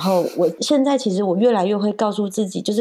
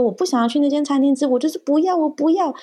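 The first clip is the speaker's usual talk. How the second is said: put-on higher, fast whining refusal of a small child; whiny child tantrum; 8 semitones higher, faster than usual. Mother mimics her son refusing the restaurant, fast and whiny. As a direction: pitch up and rush it like a kid throwing a fit.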